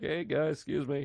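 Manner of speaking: soft, timid excuse-me; meek interruption. Retold or imitated. Comic squeezing past the flexing guys, meek and quiet. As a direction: slip in quietly, like you don't want trouble.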